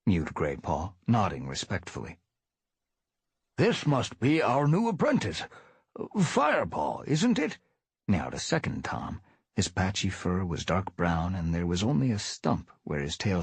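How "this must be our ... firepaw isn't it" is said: lightly voiced old tomcat; friendly old cat welcoming; elder tomcat voice. Elder tom greeting new apprentice.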